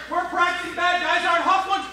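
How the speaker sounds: usual speaking voice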